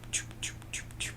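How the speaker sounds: whispered choop-choop elevator noise. A narrator's reading. Reader imitates the rising elevator, whispered choop sounds. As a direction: make the lift sound rising.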